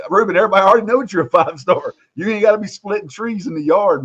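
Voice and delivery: high, fast, disbelieving outburst; incredulous exclaim. Retold or imitated. Telling Ruben he needn't split trees to prove himself, high incredulous exclaim. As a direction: pitch it up in amused disbelief at the stunt. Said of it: "I'm like".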